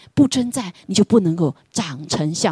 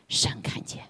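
hushed whisper; 9 dB quieter, slower than usual. Acted. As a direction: breathe the words 神看见. Hushed emphasis in sermon. (低声耳语)

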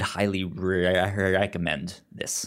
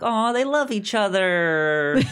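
mock sappy gush; 12 semitones higher, 4 dB louder than usual. Mock sappy reaction to ending.

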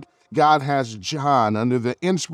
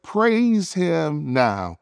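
slow urging; much slower than usual. Acted. Preacher urging praise.

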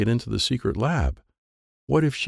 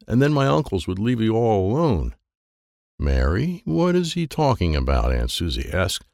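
plain reading voice

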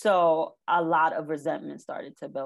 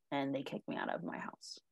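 whisper; 3 semitones lower, 11 dB quieter than usual. Real, not acted. Retelling being thrown out.

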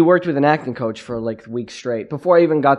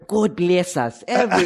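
high, hammed-up bad British accent; badly faked British, pitched high; terrible British accent; 7 semitones higher than usual. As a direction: mangle it in an awful British accent. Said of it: "the worst British accent you'll ever hear".

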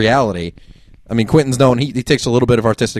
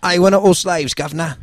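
deliberately hammed-up, bad British accent; 5 semitones higher, 3 dB quieter than usual. Co-host mocking Jack about wanting to own slaves, bad British accent. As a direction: put on a mock British voice. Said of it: "that was a really bad accent".

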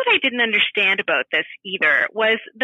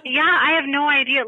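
ordinary talk, nothing marked

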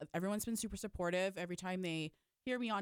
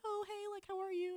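much higher-pitched chirpy hello; friendly caller greeting; 12 semitones higher than usual. Retold or imitated. Radio host to a listener calling in, high friendly greeting.

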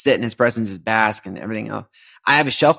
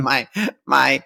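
usual speaking voice

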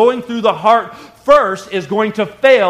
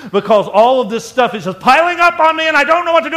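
much higher and louder, fed up and pushing people off; stressed snap; 9 semitones higher, 5 dB louder than usual. Retold or imitated. Stressed husband pushing his wife and kids away, higher and louder. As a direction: snap like a man at the end of his rope.

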